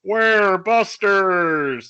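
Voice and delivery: slow, drawn-out muffled call; muffled distant voice. Acted. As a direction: shout it from behind a wall. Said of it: "a kind of a muffled voice go".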